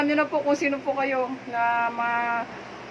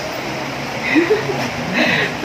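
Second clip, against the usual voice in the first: laughing; 4 semitones higher, 3 dB louder than usual. Real, not acted. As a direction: burst of laughter mid-celebration. Laughing between song lines. (tawa sa gitna ng kanta)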